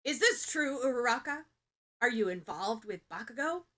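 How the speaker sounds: loud, alarmed demand; loud startled class rep questioning; urgent question. A narrator's reading. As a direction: snap around and demand the truth. Ida whips around demanding.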